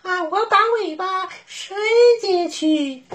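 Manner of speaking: very high, eager fast wolf voice; a wolf overjoyed at the trick; delighted. Acted. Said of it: "非常高兴".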